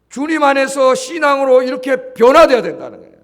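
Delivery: loud, high exhortation from the pulpit; preaching at full force. Acted. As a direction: urge the wives, slowing on 아내들이. Sermon exhortation.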